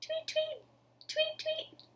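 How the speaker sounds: high, light chirping tweets; bird tweets. Acted. Birds greet Happy Lion with high chirps. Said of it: "the birds would say".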